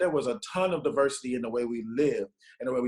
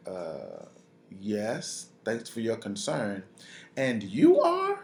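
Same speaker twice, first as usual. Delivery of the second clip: slow, hesitant thanks rising into a puzzled question; politely puzzled by a stranger, drawing the words out; wary politeness; 4 semitones lower, much slower than usual. Retold or imitated. Wary reply to unknown neighbour.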